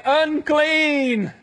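high, drawn-out call of warning; long high holler, ringing out; leper's warning yell. Acted. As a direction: yell it out like a town crier. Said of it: "They would also have to yell".

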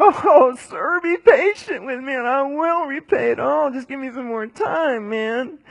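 high, loud pleading voice; begging servant. Acted.

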